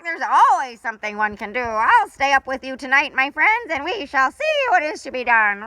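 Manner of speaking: rally the frightened friends; bold parrot. Acted. Papagayo voiced in story.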